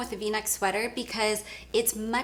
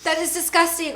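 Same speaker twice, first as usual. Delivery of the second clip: ordinary talk, nothing marked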